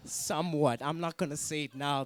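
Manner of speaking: ordinary delivery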